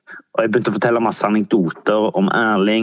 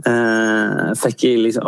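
usual speaking voice

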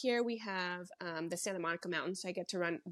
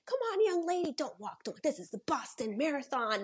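cheering spectator; 6 semitones higher than usual. Retold or imitated. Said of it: "some guy was like".

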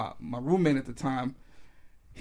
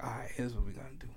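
quick whisper; 3 semitones lower, 11 dB quieter, much faster than usual. Retold or imitated. Plug after phone call, scheming.

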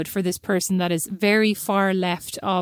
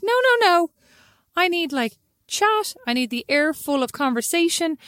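mock protest; 8 semitones higher than usual. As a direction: plead high and insistent. Protesting friend's silence, retold.

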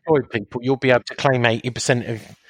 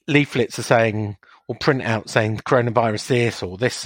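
ordinary delivery

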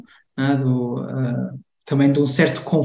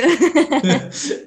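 high burst of laughter; 11 semitones higher than usual. Real, not acted. Laughs at interviewer's remark.

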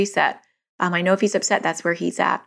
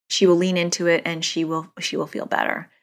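ordinary talk

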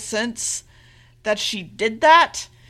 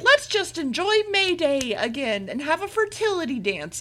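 higher, lilting imitation of merry villagers; mock cheer; 5 semitones higher than usual. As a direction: sing out a chirpy group suggestion. Voices villagers' cheery suggestion.

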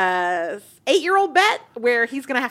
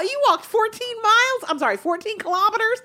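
incredulous; 12 semitones higher than usual. Acted. Mock disbelief at Beckham.

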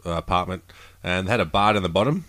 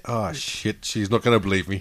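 ordinary delivery

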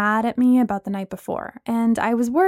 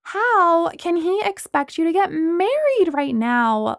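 demanding; 7 semitones higher, slower than usual. Acted. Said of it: "Lana demanded".

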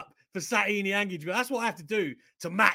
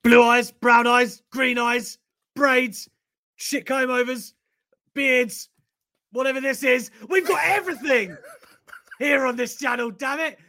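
excited shout; 7 semitones higher, 8 dB louder than usual. Real, not acted. Host hyping up his viewers, loud excited shout. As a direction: shout out the crowd like an announcer.

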